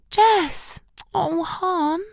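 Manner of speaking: high, soft, full of sympathy; sympathetic cry. A narrator's reading. Shelley hearing Jess sob on the phone, sympathetic. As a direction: reach out with tender sympathy. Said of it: "voice vibrated with sympathy".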